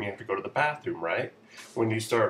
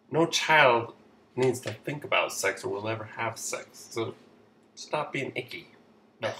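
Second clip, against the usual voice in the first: ordinary talk, nothing marked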